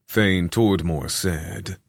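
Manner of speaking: usual speaking voice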